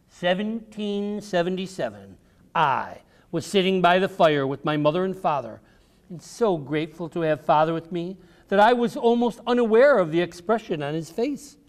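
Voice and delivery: ordinary delivery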